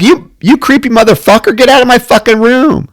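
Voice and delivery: high, indignant mock telling-off; mock outrage. Woman imagining throwing an intruder out, high mock outrage. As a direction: order the creep out in pretend fury.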